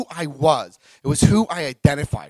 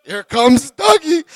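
high, loud, drawn-out mock fanfare; gleeful announcement; 10 semitones higher, 9 dB louder, slower than usual. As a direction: stretch it out like a comic arrival call. Acts out drunken drive-through arrival.

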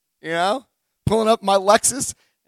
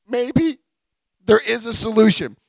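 usual speaking voice

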